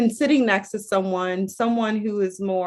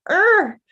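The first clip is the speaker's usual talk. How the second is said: annoyed groan; 10 semitones higher, 5 dB louder than usual. Retold or imitated. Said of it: "And I'm like".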